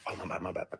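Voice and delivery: hushed backpedal; mumbled apology. Retold or imitated. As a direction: back off quietly, imitating a sheepish excuse. Retold culprit's hasty apology.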